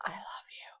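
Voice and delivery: soft unvoiced whisper; spirit whisper. Vlogger imitates the ghost saying it, whispering. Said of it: "someone whispers".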